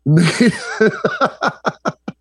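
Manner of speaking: real laugh breaking out mid-word; burst of laughter. Real, not acted. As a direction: crack up helplessly at an absurd idea. Cracks up at native Cajun line.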